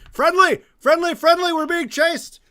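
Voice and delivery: loud high shout up the well; yelling up. Acted. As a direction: call out loudly to be heard above. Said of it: "I wanna yell up".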